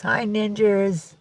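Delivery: slow, high singsong greeting; greeting passed to the cat. Pretend. Owner repeating a viewer's hello to her cat, singsong. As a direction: stretch it out high and sweet. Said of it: "Cat says".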